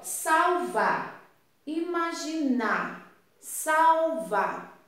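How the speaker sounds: SALVAR and IMAGINAR drawn out slowly, last syllables ringing; rhyming endings stressed. Shown as a demonstration. Teacher comparing two word endings for pupils, slow stressed rhyme. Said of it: "Olha como parece o final do som".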